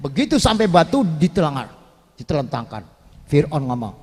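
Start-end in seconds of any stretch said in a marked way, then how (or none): none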